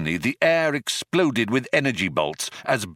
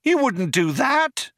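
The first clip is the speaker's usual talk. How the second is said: much higher, slow outcry; Trillian crying out; slower than usual. A narrator's reading. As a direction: cry out in disbelief. Trillian continues.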